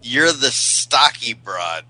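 drawn-out, measured repeat of STOCKY BROAD; slow deliberate repeat. Real, not acted. Can you repeat that again.

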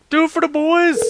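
high, loud cheer; rallying yell. Acted. Prank caller rallying himself to redial, high rallying yell. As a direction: yell it out like a battle cry.

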